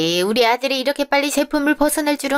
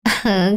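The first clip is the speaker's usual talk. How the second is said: polite laugh; 3 semitones lower than usual. Acted. Mother-in-law answers father's greeting.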